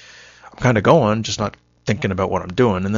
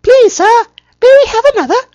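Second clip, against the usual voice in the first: very high, loud, pleading put-on voice; orphan begging impression; 14 dB louder than usual. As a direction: beg like a starving orphan, shrill and loud. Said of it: "I can't really do impressions".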